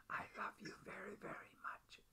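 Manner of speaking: soft unvoiced whisper of love. Real, not acted. Husband whispering love to his wife in care, whispered. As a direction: breathe it close to her ear. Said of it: "I'm gonna whisper to you".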